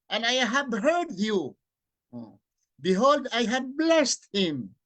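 slow, loud, solemn Bible reading; scripture reading. Acted. Preacher reading the Bible verse aloud to listeners, solemn declaiming. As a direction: proclaim each verse slowly to the audience.

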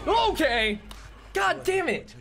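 high, loud outburst of alarm; exasperated shouting. Real, not acted. Player whose predicted scare just happened, exasperated shouting. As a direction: yell it high, vindicated and fed up.

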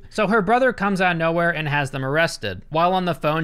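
normal talking voice

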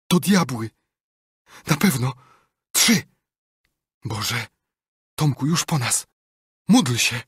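high, loud panicked voice; terrified cry. Acted. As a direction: terror bursting through a whisper. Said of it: "Huck wyszeptał z przerażeniem".

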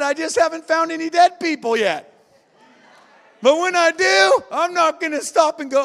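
high, loud, shouting sermon; fiery preaching. Acted. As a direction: preach it with full fire to the crowd. Preaching at full force.

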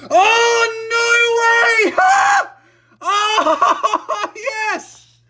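losing it over a dream pull; real joy, shrieked at full volume; ecstatic screaming. Real, not acted. Pulls the dream card.

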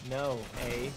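put-on Canadian accent. Friend repeating the requested joke, put-on Canadian accent. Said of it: "Give me your best Canadian accent".

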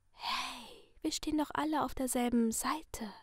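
sinister masked figure in a soft whisper; menacing whisper from behind a mask; creepy whisper. Acted. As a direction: purr the threat like a friendly killer. Letty whispers.

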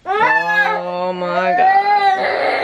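high, very slow exclamation; drawn-out oh my god. Real, not acted. Mom exasperated at her overreacting daughter, drawn out. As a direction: stretch it out in disbelief.

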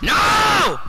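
high, loud scream of dismay; rage scream. Real, not acted. Gamer watching it go wrong, rage scream. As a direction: scream in real frustration.

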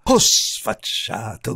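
loud, slow, drawn-out outrage; outraged cry. A narrator's reading. Countess losing her temper at the visitor, outraged. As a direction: cry out, scandalized. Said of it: "gridò la contessa".

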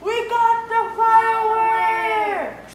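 slow, loud cry of victory; excited shout. Acted. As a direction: shout it out in triumph. Victory over villain.

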